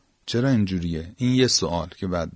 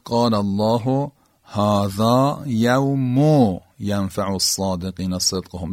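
Quran recitation; 3 dB louder, slower than usual. Acted. Teacher recites Quran verse.

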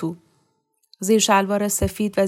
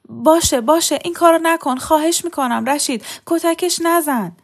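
much higher, slowing frantic begging; desperate pleading; 9 semitones higher, 5 dB louder than usual. A narrator's reading. Young wife desperately begging husband not to beat Mariam. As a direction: beg him to spare her.

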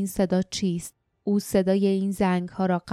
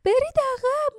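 second, more desperate cry of برید عقب; girl shouting. A narrator's reading. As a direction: repeat برید عقب pitched up further. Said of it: "جاسمین فریاد زد".

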